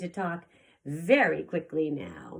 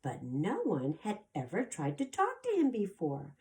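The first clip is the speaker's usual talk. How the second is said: ordinary delivery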